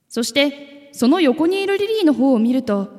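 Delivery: ordinary delivery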